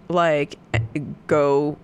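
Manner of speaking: usual speaking voice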